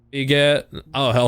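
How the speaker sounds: normal talking voice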